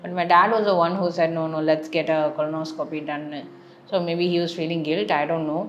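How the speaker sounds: usual speaking voice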